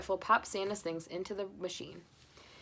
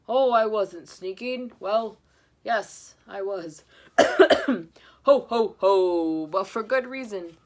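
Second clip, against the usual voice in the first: put-on slow, loud jolly old man; Santa; 12 dB louder, much slower than usual. Santa explaining himself, booming. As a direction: chuckle through the confession.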